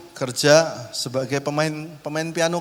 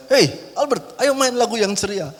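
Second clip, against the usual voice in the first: cheery owner; 7 semitones higher than usual. Retold or imitated. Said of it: "lihat saya".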